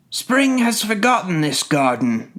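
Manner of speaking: high-pitched snow and frost spirits; crying out gleefully. A narrator's reading. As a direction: crow it in a high sprite's voice. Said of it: "they cried".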